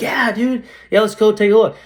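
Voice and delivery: high-pitched, keen yes to a buddy; acted eagerness. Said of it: "someone who loves what they do".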